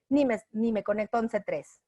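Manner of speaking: ordinary talk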